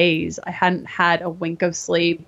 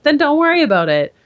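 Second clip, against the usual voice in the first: higher, brushing-off doctor voice; breezy dismissal; 7 semitones higher, 4 dB louder than usual. Retold or imitated. Doctor brushing off a patient's missing period, breezy. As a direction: wave the worry away lightly. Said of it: "And she's like".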